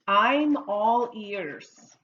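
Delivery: slow, drawn-out teacher demonstration; slow model phrase. Shown as a demonstration. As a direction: stretch each word out for learners to repeat. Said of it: "Why don't you practice that one with me?".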